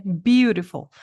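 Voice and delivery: "beautiful" slow with BEAU punched; stress the first syllable. Shown as a demonstration. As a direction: lean hard on BEAU. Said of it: "ponha a sílaba a primeira mais forte".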